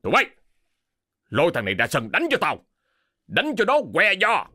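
angry master commanding servants; harsh, escalating command; barked order. A narrator's reading. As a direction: order them out, rising to a high yell. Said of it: "ra lệnh cho đám gia nhân".

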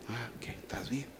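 mock-agreeing whisper; sarcastic whisper. Parent whispering sarcasm at the stubborn child. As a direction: breathe it out with sarcasm.

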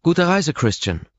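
a brisk, curious assistant; Ross's voice. Acted.

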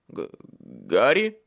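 halting, low start rising into a question; uncertain. A narrator's reading. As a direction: stammer the name, slow and unsure. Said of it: "неуверенно произнёс он". (неуверенно, запинаясь)

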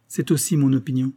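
ordinary delivery, nothing marked

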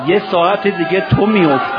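very loud mourning cry; anguished cry. Acted. Preacher voices Abbas fearing Imam's fall, anguished cry. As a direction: cry it out to a weeping congregation. Climax of dying man's quote.

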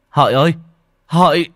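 loud urgent call. Acted. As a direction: shout her name across the yard. Husband calling out to wife.

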